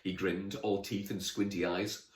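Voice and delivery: ordinary delivery, nothing marked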